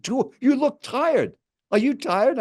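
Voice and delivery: high-pitched retold disbelief; insistent quoted challenge; incredulous. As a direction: imitate a pushy stranger questioning someone's stamina. Stranger challenges him in retelling.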